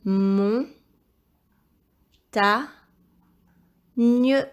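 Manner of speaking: loud, isolated syllables with long pauses; syllable-by-syllable dictation. Shown as a demonstration. Teacher helping pupil spell montagne, syllable-by-syllable dictation. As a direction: break "Mon. Ta. Nieu." into separate beats.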